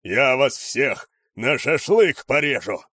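loud, slow bellowed threat; raging slow roar. A narrator's reading. Cook threatens rats.

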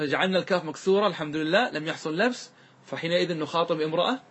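ordinary delivery

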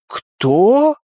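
high, rising puzzled question; bewildered. A narrator's reading. Friend hearing an unexpected name, bewildered. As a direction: ask in bafflement. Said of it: "с недоумением спросила подруга".

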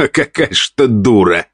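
groaning through laughter; laughing groan. A narrator's reading. Count laughing at his wife's gossip, groaning laugh. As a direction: laugh it out at her. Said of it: "простонал мужчина".

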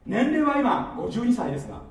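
loud, high-pitched proclamation; emphatic declaration. Real, not acted. Speaker proclaiming age means nothing, emphatic declaration. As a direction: build into a full-voiced shout.

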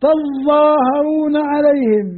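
تظاهرون عليهم slow with a heavy doubled ظ; heavy recitation. Shown as a demonstration. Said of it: "تطلع من ثم ظاء مشددة ثقيلة".

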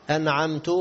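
"أنعمت" ending in tu; common mistake. Retold or imitated. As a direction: say "أنعمت" as an'amtu. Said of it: "خطأ يبطل الصلاة". («أنعمت» بضم التاء خطأً)

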